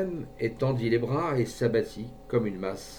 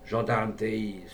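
ordinary delivery

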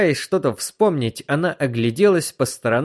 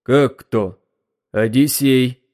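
dull, hollow stranger's voice; muffled voice; slower than usual. A narrator's reading. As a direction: answer in a muffled tone. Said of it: "глухим голосом".

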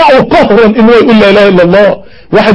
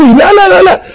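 higher insistent no, no, no; emphatic no; 8 semitones higher than usual. Real, not acted. The preacher refusing the idea to his listeners, emphatic. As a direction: cut them off firmly. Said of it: "preacher insists".

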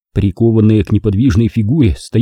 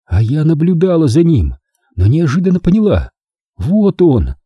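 higher, bitter woman's confession; Naomi confessing; 7 semitones higher than usual. Acted. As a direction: tell it like a guilty woman unburdening herself.

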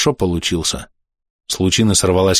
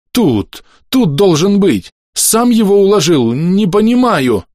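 high, loud whining; скулёж; 11 semitones higher, 4 dB louder, slower than usual. A narrator's reading. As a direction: whine in helpless confusion. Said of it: "заскулил санитар". (скулящим высоким голосом, растерянно)

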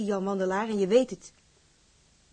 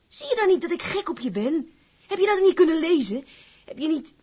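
pleading; 8 semitones higher, 6 dB louder than usual. Acted. Pleading confession of love.

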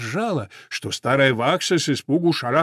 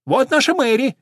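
high, loud announcement; sparrow calling out; 11 semitones higher, 5 dB louder than usual. A narrator's reading. Sparrow introduces Мэри to old horse, calling out. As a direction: present her proudly, pitched right up. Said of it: "закричал воробей".

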